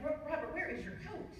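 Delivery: a mild pitch-up alarmed mom; startled mother. Retold or imitated. Mother questions wet husband.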